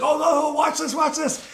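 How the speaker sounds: high, loud cocky guard shouting watch this; showing-off guard. Retold or imitated. Guard boasting to his friends, high and loud. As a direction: crow it like a guard about to do something stupid.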